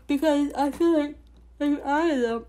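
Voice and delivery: talking through a yawn; words stretched by a yawn. Real, not acted. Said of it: "I'm so sorry for yawning".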